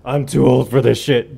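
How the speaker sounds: lightly voiced grumpy old man. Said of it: "Get off my lawn voice".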